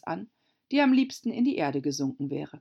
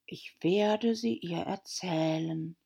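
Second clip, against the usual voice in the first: deep hollow voice; slower than usual. A narrator's reading. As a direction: draw it out in a hollow, mournful voice. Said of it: "mit tiefer, hohler Stimme". (tiefe, hohle Stimme, langsam)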